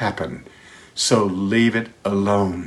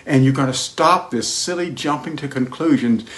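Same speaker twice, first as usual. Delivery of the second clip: ordinary talk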